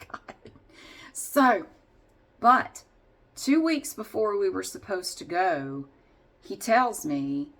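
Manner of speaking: usual speaking voice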